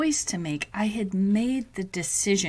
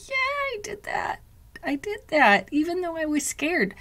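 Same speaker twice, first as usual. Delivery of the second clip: proud; 9 semitones higher than usual. Retold or imitated. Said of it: "I can look back and be like".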